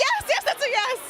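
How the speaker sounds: high, thrilled yes; excited. Retold or imitated. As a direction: squeal the yes with joy. Retold overjoyed answer to Ava.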